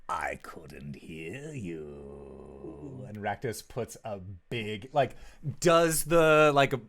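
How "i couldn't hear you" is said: very slow whisper, leaning in; mocking taunt. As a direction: make him beg again. Rakdos mocks, taunting.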